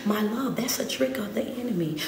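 soft, whispered caution; hushed warning. Real, not acted. Preacher warning the congregation in a hush. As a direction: lean in and warn them quietly.

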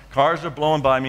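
ordinary talk

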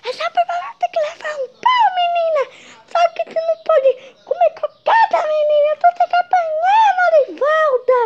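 very high shrill angry mother; scolding mom. Acted. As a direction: yell at the naughty kid.

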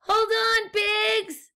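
high, loud shout to hold on; urgent shout. A narrator's reading. Explorer guinea pig yells to the pigs in the quake. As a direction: bellow the order over the shaking.

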